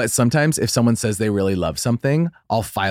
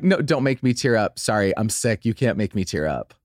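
ordinary talk, nothing marked

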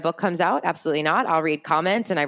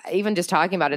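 normal talking voice

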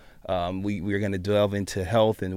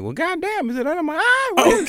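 alarmed; 6 dB louder than usual. Retold or imitated.

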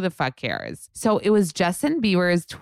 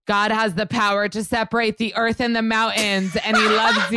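preachy post; 6 semitones higher than usual. Retold or imitated. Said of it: "wakes up super preachy".

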